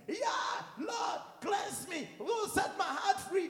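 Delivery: high, loud pleading shout; fervent mock prayer. Preacher mimicking someone in the prayer room, fervent mock prayer shout. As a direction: cry out in hammed-up prayer.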